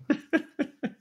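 very high burst of laughter. Real, not acted. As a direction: crack up at the story. Laughing at own story.

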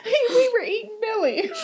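laughing through tears; high laugh-cry. Real, not acted. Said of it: "I'm laugh crying".

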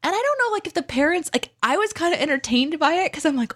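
ordinary delivery

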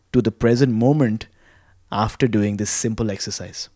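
soft, unhurried guided breathing voice; calm meditative tone. Acted. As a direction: guide them gently through each breath. Leads breathing exercise.

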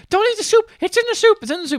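frantic, very high-pitched mock scream; imitating a high screamed alarm. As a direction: squeal it out like a panicked warning. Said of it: "starts screaming like".

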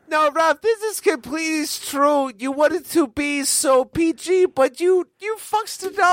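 high whiny sidekick voice; a hint of a whiny sidekick; Benny from The Mummy. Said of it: "You sound like Benny from The Mummy".

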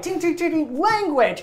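high sing-song tings then a scolding "language!"; tap, tap, then tut-tut; mock prim scold. GM voices Tiri tinging and scolding the goblin, mock prim. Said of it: "Tiri, you're like".

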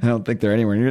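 ordinary talk, nothing marked

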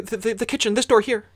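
put-on high, stammering small-boy voice; urgent child. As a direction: point the way in a rush. Child points out the door.

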